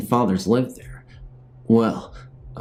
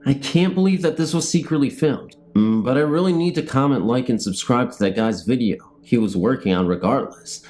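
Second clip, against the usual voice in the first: TV show narration; 4 semitones higher than usual. Acted. Vlogger narrating his made-up show outro, announcer style. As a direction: introduce the episode like a show host.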